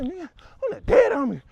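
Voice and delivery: high, loud mock tough talk; acted bravado. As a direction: play the cocky crew, loud and high. Acting out cocky crew before shooting.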